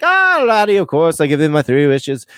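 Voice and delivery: put-on dodgy Irish-or-Scottish accent; comic Irish accent. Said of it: "don't even know if that's Scottish or Irish".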